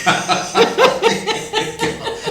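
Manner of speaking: real laugh bursting out; loud high laugh. Real, not acted. Host laughs at accents. (carcajada aguda)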